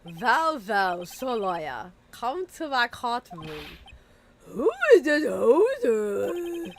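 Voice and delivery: slow, loud theatrical villain; booming character voice. Acted. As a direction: drag it out like a pompous villain. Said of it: "You and these voices".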